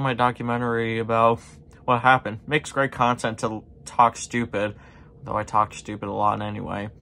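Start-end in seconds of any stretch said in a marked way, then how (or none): none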